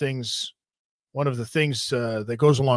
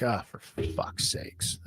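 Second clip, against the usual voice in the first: exasperated sigh; 3 semitones lower, 13 dB quieter, much slower than usual. Retold or imitated. Cops have been called.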